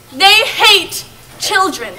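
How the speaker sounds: slow, ominous. Acted.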